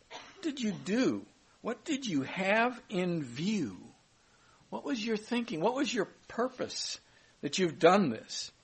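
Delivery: lightly voiced puzzled king; questioning Abimelech.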